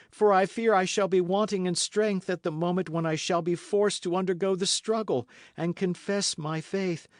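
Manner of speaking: a hint of a desperate captive; despairing woman's plea. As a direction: beg him as a martyr would. Milady's desperate appeal.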